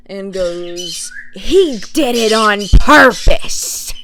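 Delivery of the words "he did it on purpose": loud, slow, outraged accusation; accusing aunt. Retold or imitated. Imitating aunt blaming a laughing toddler, loud drawn-out outrage. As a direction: hammer out each word as the indignant aunt. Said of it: "And she goes".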